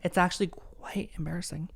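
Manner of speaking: a hushed embarrassed aside; whispered aside. Real, not acted. Reading her old letter's typos, whispered embarrassed aside.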